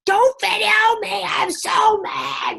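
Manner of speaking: put-on high, loud, slow child's scream; shrieking toddler tantrum; son screaming. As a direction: scream it like a furious four-year-old. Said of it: "my son screaming".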